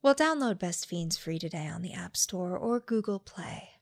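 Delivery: low, soft sultry ad read; sultry narrator. Acted. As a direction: purr the ad like a late-night host. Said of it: "getting down to sultry voices here".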